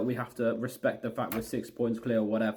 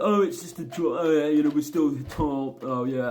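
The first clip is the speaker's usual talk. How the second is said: mocking, louder stupid-fan voice; 2 semitones higher, 5 dB louder than usual. Ranting fan mimicking stupid supporters happy with a draw, mocking. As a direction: mimic a clueless, easily pleased supporter. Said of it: "some fans that are stupid".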